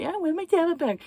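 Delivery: high mock kid whine; whiny child. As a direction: imitate a spoiled kid begging for the tablet. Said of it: "They whine, they cry".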